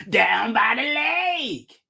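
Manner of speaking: slow, shouted preacher voice, lake drawn out; Lovell Crawford impersonation. Retold or imitated. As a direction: belt down by the lake, stretched and slow. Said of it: "That's my Lovell Crawford impersonation".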